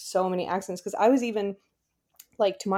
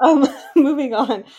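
laughing; 6 semitones higher, 7 dB louder than usual. Real, not acted. Laughing while changing the subject.

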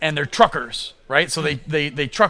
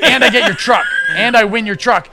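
rising excitement, louder and higher; excited; 5 semitones higher, 5 dB louder than usual. Real, not acted. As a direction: pitch it with growing hype. Excited pitch of comic plot.

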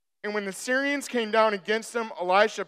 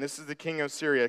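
usual speaking voice

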